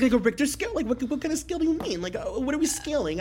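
high, fast, flustered mock; mocking confused Jules. Friend imitating Jules lost about the scale, high and flustered. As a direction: play her baffled and tripping over words.

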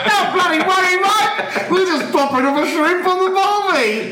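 hammed-up, high Aussie accent; mock Australian. Co-host sending up the snake handler, hammed-up Australian accent. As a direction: do a high, over-the-top Australian. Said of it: "I just don't know why he's Australian".

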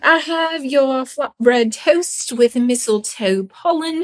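slow, lofty waiter; snooty waiter voice. Pretend. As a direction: present the dish like a posh server. Serving the fancy bog meal.

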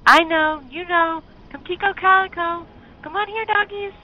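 very high, loud call; calling again. Acted. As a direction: plead for the dogs at full voice. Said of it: "so he called them again".

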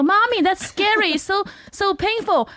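put-on high-pitched, slow whining child voice; imitated little son. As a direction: mimic a small child complaining to Mommy. Voices her young son watching cartoon.

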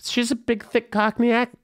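high-pitched, badly faked Cockney; hammed-up Cockney impression; mock Cockney accent. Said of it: "worst [name] accent I've ever, ever attempted".

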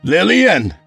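high, loud call of LILLIAN. Acted. Husband forces his way in, loud calling. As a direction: call for her through the house.

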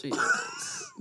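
squeaky high. Pretend.

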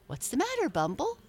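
high, lilting call; merry cry. A narrator's reading. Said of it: "cried the Merry Little Breezes".

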